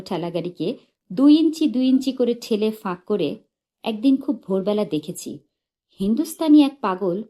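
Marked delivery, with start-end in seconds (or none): none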